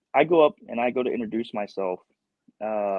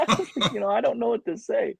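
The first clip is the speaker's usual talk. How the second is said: laughing; 13 semitones higher than usual. Real, not acted. Laughing while retelling embarrassment.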